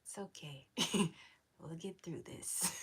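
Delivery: soft, hushed comforting, fading to a whisper; soothing there there. Pretend. To a crying stranger, hushed soothing murmur. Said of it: "Be like".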